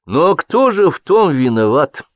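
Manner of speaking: aggrieved orator, stretched words; indignant questioning. A narrator's reading. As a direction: draw out the question in mild indignation. Acted quarrel at editorial meeting.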